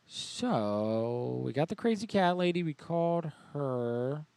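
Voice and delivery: normal talking voice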